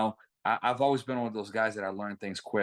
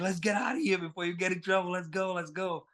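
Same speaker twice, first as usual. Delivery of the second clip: high, hurried friend's voice; quick, high-pitched mock urgency; urgent retold urging; 8 semitones higher than usual. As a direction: imitate a buddy rushing someone away. Friend urging him to flee.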